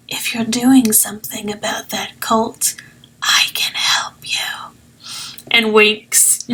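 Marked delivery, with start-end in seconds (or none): 0.1-4.7 hushed conspiratorial whisper. Acted. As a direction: lean in and breathe it secretly. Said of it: "leans in close to Nim and goes".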